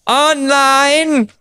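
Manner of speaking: high, very drawn-out mock announcer; stage introduction. Comic mocking how his friend brings him up, drawn-out emcee. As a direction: stretch it out like a hammed-up emcee. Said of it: "and you bring me up".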